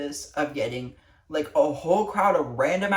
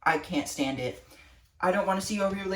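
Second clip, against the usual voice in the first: normal talking voice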